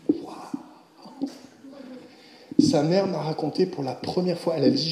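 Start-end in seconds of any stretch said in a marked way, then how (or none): 0.1-1.3 awed whisper. Real, not acted. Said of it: "awed whisper".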